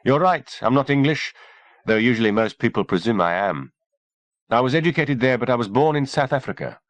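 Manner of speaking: a hint of a cool woman doctor. A narrator's reading. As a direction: lightly voice the poised doctor. Kimberly voiced, calm doctor.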